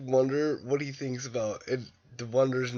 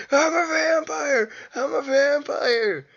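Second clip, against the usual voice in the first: much higher, louder, slow crying shout; mock yell; 12 semitones higher, 6 dB louder, slower than usual. Man running into the street, hammed-up crying shout. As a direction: scream it out like a madman in the street. Cage runs into street yelling.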